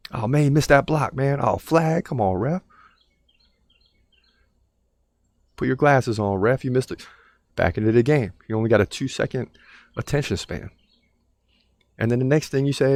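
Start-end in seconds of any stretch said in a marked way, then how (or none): 0.1-7.1 shouting at the ref; yelling at the tv. Retold or imitated. Man yells at game on TV.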